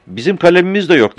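high, loud call from a crowd. A narrator's reading. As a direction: call out a complaint across the street. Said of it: "diye seslendiler".

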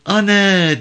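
loud, high cry for mother; imagined daughter calling out loudly; child's shouted call. A narrator's reading. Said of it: "diye bağırdığını duyar gibi".

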